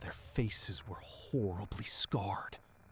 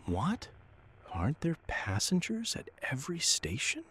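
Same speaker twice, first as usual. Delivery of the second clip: rising, higher-pitched puzzled questions; surprised; 6 semitones higher, 5 dB louder than usual. A narrator's reading. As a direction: ask it in open surprise, voice climbing.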